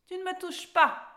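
high, loud indignant warning; exclaiming cat. A narrator's reading. Cat stops Alice as she admires him, exclaiming. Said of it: "s'exclama alors le chat".

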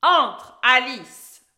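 booming, very loud order; commanding troll. A narrator's reading. Troll orders Alice into his cave, commanding. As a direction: bark the order at full volume. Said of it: "ordonna le troll".

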